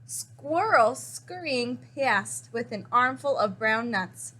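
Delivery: ordinary delivery, nothing marked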